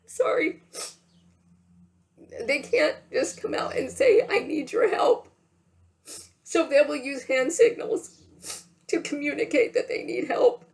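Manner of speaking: high, tight, teary voice; fighting back tears. Real, not acted. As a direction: talk on through real tears. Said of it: "I'm getting very emotional right now. I usually don't cry".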